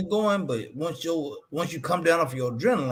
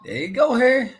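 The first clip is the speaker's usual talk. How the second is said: teasing call; 9 semitones higher, 4 dB louder than usual. Acted. Teasing Harry, sing-song call.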